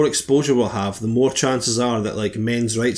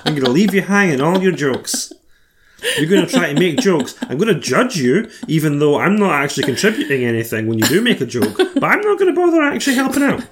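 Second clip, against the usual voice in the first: higher, whining imitation of a tired co-host; whiny mock impression; 3 semitones higher than usual. Teasing her co-host by imitating her tired moaning, in a high whiny put-on voice. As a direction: whine it out like a sulky, exhausted podcaster mocking her friend. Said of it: "you were like".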